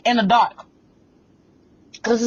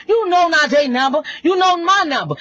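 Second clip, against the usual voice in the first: crying shout; 10 semitones higher than usual. Real, not acted. Confronting her ex on live, shouting and crying. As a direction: shout it through tears at her. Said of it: "upset, crying shout".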